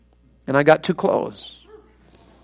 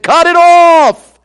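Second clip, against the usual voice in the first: high, loud, drawn-out command; preaching shout; 11 semitones higher, 8 dB louder, much slower than usual. Retold or imitated. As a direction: re-enact the wound-up preacher's cry. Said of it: "I'm just preaching away".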